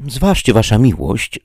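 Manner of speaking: lightly voiced worried servant; Sancho's warning. A narrator's reading. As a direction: hold the master back politely. Sancho warns his master.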